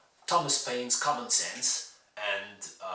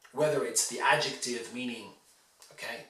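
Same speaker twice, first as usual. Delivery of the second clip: usual speaking voice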